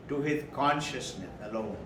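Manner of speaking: normal talking voice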